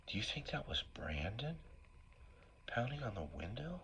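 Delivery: soft whispered question to mom; hushed question. Retold or imitated. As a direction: breathe it out in awe. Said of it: "I say".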